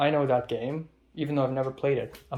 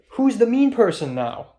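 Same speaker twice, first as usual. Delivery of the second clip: indignant jab; 7 semitones higher, 6 dB louder than usual. Real, not acted. Indignant rhetorical jab at Thomas.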